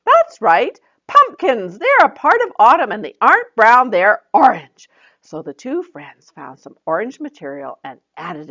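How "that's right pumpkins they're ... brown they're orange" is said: higher, louder cheerful praise then hint; Joy encouraging. Acted. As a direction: praise her and leave a gap.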